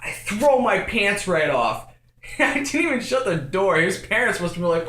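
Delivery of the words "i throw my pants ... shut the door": usual speaking voice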